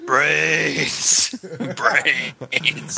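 lightly voiced zombie groan; a hint of a Vonnegut zombie. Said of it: "that's my Vonnegut zombie voice".

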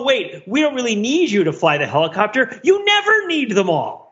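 usual speaking voice

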